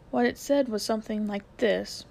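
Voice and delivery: plain reading voice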